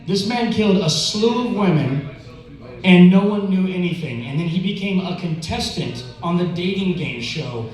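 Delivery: normal talking voice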